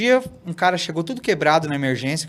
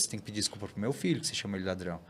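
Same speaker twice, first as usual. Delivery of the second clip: low, quiet and fast, pressing the shopkeeper; father quietly scolds the shopkeeper, fast and hushed; quiet firm scolding; 5 semitones lower, 12 dB quieter, much faster than usual. Retold or imitated.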